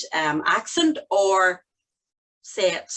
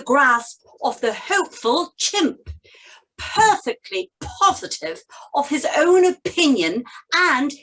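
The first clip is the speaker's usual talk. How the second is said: higher, theatrical posh English RP; posh English accent; 5 semitones higher than usual. Shown as a demonstration. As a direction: read it in very posh received pronunciation, pitched up and crisply clear. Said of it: "it's a very posh English accent".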